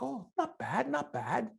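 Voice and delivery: coach's high impressed not bad; impressed approval. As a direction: sound grudgingly impressed. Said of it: "And I'm like".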